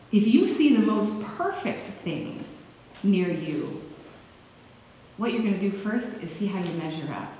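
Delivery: ordinary talk, nothing marked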